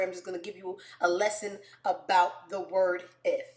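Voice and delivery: usual speaking voice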